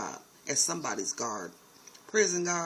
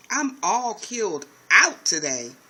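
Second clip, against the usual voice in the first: louder, slow disbelieving echo; incredulous repeat; 2 semitones higher, 7 dB louder, slower than usual. Real, not acted. Vlogger echoing Huck, loud and incredulous. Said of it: "That's crazy".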